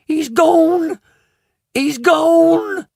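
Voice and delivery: high, loud shriek of panic; screaming. A narrator's reading. Mother screams as her son vanishes, shrieking. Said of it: "and she screamed".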